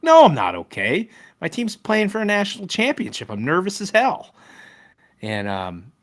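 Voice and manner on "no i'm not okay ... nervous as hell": higher, louder, hammed-up nervous fan; mock nervous outburst. Telling the family at dinner, hammed-up nervous outburst. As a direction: blurt it out like a fan losing it. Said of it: "I would be like".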